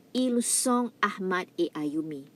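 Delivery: "Ils sont Ahmad et Ayumi." s of ils silent, sont nasal; careful French pronunciation. Shown as a demonstration. Teacher asking students to listen to the pronunciation. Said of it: "Please listen carefully to the pronunciation".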